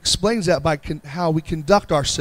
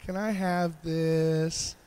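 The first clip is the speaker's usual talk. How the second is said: put-on softer, slow childlike asking; sweet little-child ask; 8 dB quieter, much slower than usual. Pastor imitates a great-grandbaby asking sweetly, soft and slow. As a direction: ask like a great-grandbaby who knows how to get it. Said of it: "they say it in just the right way".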